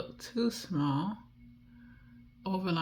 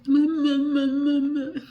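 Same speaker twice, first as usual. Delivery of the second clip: put-on much higher and louder baby babbling; baby babble; 12 semitones higher, 9 dB louder, slower than usual. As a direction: squeal it like a baby. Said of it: "reminds me of a baby".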